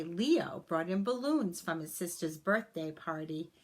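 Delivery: ordinary delivery, nothing marked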